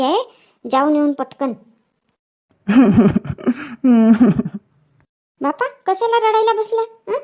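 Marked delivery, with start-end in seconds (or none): 2.7-4.6 long falling wail, low and loud; a loud sobbing cry; loud wailing sob. Acted. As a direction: let out a big anguished wail. Son sits crying on sofa.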